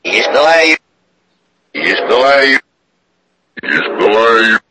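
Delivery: ordinary talk, nothing marked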